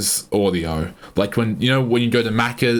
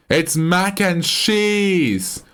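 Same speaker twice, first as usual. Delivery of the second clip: emphatic exclamation; 7 semitones higher, much slower than usual. Real, not acted. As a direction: proclaim "mac and cheese" like a discovery, stretching each word. Triumphant restatement of dish name.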